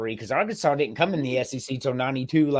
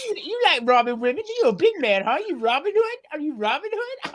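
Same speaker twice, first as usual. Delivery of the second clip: high, mocking taunt, higher all through; mock taunting woman; 11 semitones higher than usual. Retelling the British woman jeering at the robber, high mocking taunt. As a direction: needle a would-be robber like a scornful old lady. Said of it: "She's like".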